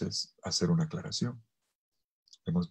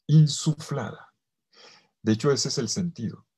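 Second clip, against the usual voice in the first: ordinary talk, nothing marked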